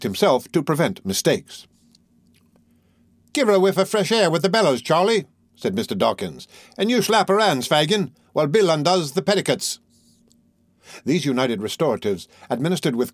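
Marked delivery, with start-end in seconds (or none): 3.3-5.3 put-on high, quick young rogue; brisk Artful Dodger. A narrator's reading. Dodger directs Charlie to revive Nancy, high and brisk. 6.8-9.8 put-on high-pitched young rogue; brisk Artful Dodger. A narrator's reading.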